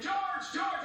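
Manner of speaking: calling out in panic; screamed name. Retold or imitated. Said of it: "he starts screaming".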